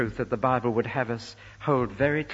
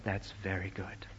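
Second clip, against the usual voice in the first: low, very soft near-whisper; hushed approval; 6 semitones lower, 12 dB quieter than usual. Acted. Preacher voices God approving the people He made, hushed. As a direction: drop right down to a hushed pronouncement.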